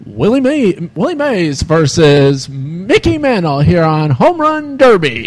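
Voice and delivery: put-on old-time game show announcer, loud and swooping; Mark Scott. Co-host mimics the fifties derby host, grand announcer voice. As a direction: stretch the names out with big showman pitch swings. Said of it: "the nineteen fifty-nine game show with Mark Scott".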